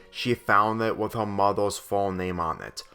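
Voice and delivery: usual speaking voice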